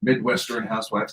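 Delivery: ordinary talk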